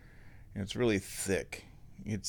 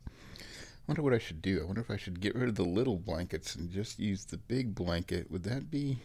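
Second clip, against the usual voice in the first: musing to self; 6 dB quieter than usual. Real, not acted. Musing to himself about blankets.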